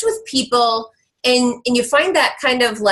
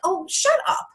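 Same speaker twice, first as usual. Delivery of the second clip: higher, scoffing shut up; dismissive retort; 9 semitones higher, 3 dB quieter than usual. Retold or imitated. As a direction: brush off the whining. Told whiners at boot camp.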